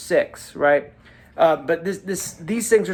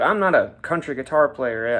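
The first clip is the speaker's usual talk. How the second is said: ordinary delivery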